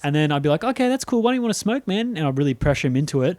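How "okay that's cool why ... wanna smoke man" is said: high, sweet fake-friendly coax; mock coaxing. Narrator luring his brother back to weed, coaxing. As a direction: pressure him in a syrupy voice.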